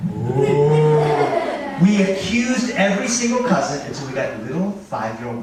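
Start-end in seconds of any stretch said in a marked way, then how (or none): 0.1-1.8 drawn-out ghostly ooh moan; spooky moan. Retold or imitated. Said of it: "And then".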